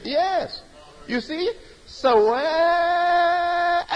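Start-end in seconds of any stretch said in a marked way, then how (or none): 1.9-3.8 Sawa sung higher and held, then cut off sharp at the hamza. Shown as a demonstration. Teacher demonstrates the hamza ending for students, sharp cut-off. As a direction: stretch Sawa, then stop it dead. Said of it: "Cut it off right sharp".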